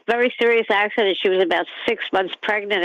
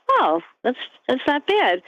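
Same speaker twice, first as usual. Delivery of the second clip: mock surprise; 6 semitones higher than usual. Cousin's reaction in retold story.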